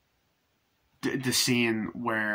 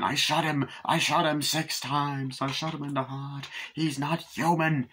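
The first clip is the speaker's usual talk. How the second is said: slow, higher, emphatic film quote; agitated Loomis; 5 semitones higher than usual. Retold or imitated. Loomis insists the killer is not human, agitated. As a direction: draw out each dire claim, pitched up. Quoting Loomis's iconic line again.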